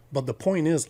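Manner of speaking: ordinary talk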